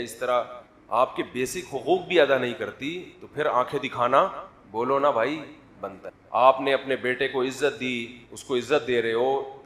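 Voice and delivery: ordinary talk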